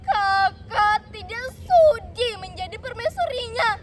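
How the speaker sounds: high, loud, heartfelt denial; impassioned older sister; emotional protest. A narrator's reading. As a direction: insist with feeling to a hurt sibling. Sister tearfully rejects being queen.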